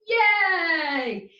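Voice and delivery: high, loud, drawn-out yay; excited cheer. Shown as a demonstration. Teacher caps the chanting exercise, high loud cheer. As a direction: cheer it out, falling at the end.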